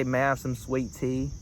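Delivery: soft, casual Southern accent demo. Shown as a demonstration. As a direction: run the words together like a Southerner. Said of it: "doing a Southern accent".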